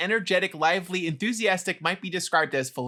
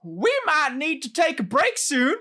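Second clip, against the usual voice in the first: Southern accent; 9 semitones higher, 3 dB louder than usual. Shown as a demonstration. Asked to read the phrase in a Southern accent. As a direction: lay on a thick Southern accent. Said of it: "do it in your Southern accent".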